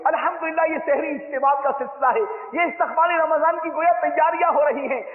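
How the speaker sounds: high, loud, fervent sermon voice; full-force preaching. Acted. Preacher urging listeners to prepare for Ramadan, loud and high. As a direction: rouse the crowd for Ramadan.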